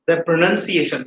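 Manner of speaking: ordinary talk, nothing marked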